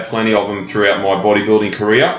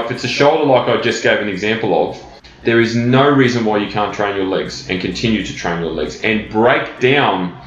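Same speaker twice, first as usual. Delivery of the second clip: ordinary delivery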